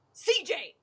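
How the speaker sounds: high-pitched yell, back from the mic; mock-exasperated holler from a step away; exasperated home yell. Shown as a demonstration. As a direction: yell his name like scolding the TV at home. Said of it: "I yell at the most".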